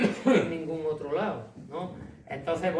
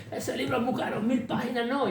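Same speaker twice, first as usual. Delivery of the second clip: much higher, sing-song refusal; mocking editor; 9 semitones higher than usual. Editor refusing a thousand-page book, high mock voice. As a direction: hand the editor's refusal back in a high, put-on voice.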